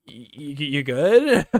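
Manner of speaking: loud, slow stammered check-in; stuttering, drawn-out mock concern; hesitant concern. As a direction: imitate a boss stumbling into an uneasy question. Boss checks on him after encounter.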